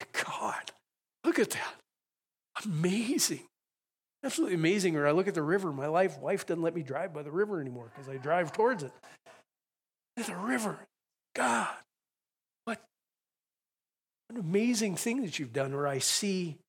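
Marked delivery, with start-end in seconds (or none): 0.1-5.0 higher-pitched, awestruck exclamations; re-enacted awe. Preacher to God on seeing mountains, awestruck. 10.2-15.7 high-pitched, halting amazement; re-enacted wonder. Driver catching sight of the river, awed prayer to God.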